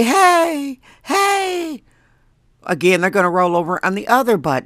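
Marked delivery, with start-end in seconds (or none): 0.0-1.8 high, drawn-out mock call. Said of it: "If you say".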